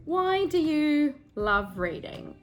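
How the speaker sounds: ordinary talk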